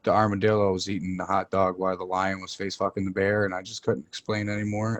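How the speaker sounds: ordinary talk, nothing marked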